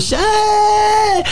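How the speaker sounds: high, drawn-out "hey" holler; long high party whoop; imitated crowd yell. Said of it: "everybody yelling".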